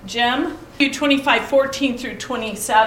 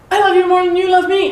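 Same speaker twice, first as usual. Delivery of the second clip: higher, louder and fast; quick playful outburst; 8 semitones higher, 9 dB louder, faster than usual. Retold or imitated. A young niece races to say it first on the phone, high and loud. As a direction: blurt it out to win before hanging up.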